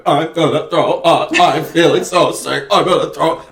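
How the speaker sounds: queasy mock wail; John C. Reilly. Imitating actor about to vomit, queasy wail. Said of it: "Reilly going".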